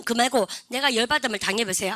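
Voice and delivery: ordinary delivery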